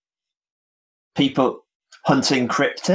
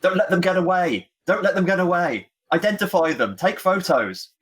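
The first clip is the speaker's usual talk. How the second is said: acted shout of alarmed orders; mock shout; 8 semitones higher than usual. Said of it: "and I sort of shout".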